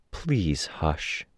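soft, hushed plea; gentle, calm. A narrator's reading. Nursery asks threatening Snag to let her work, gentle and calm. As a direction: quietly ask him to be still. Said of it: "She sounded gentle, calm".

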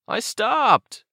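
very high character protest; protesting kid. Acted. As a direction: pitch it way up in self-defence. Aiden protests as the car honks.

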